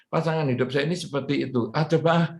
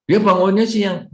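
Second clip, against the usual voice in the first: exasperated complaint; 7 semitones higher, 6 dB louder than usual. Shown as a demonstration. Complaint set against acceptance.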